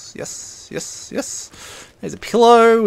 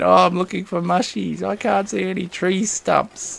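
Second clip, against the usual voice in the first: usual speaking voice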